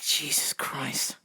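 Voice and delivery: slow, hushed mock disbelief; stunned whisper. Worker reacting to yelling customer, stunned whisper. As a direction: let it out slowly as a shocked whisper.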